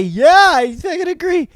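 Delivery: high, far-off airhead voice; hammed-up vapid agreement; mock yes-man. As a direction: play a brainless agreeing celebrity. Mocking a celebrity's yes-man answer.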